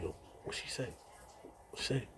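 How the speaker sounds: soft unvoiced whisper; whispering to a friend behind the officer's back; whispered question. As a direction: whisper it so the guard won't hear. Asking secretly, guard nearby.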